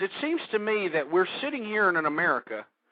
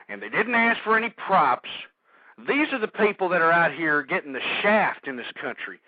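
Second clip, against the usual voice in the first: normal talking voice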